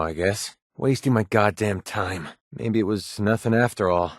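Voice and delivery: usual speaking voice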